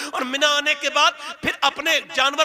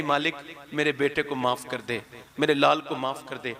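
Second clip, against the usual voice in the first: lower, soft plea for forgiveness; tender plea; 9 semitones lower, 3 dB quieter than usual. Acted. Mother asking God to forgive her son, tender. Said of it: "फिर कहेगी".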